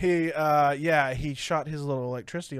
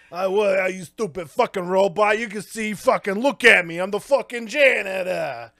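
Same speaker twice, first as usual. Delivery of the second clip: raised, louder mock tirade; indignant character rant; acted angry janitor; 3 semitones higher, 6 dB louder than usual. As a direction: tell off a machine in hammed-up outrage. Acting out janitor cursing robot.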